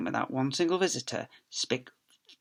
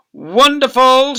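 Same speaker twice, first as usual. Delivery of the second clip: high, loud, drawn-out delight; a slow gleeful cry; exclaimed; 11 semitones higher, 15 dB louder, much slower than usual. Acted. Miss Spick delighted by the crisp packet, loud slow exclaim. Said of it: "she exclaimed".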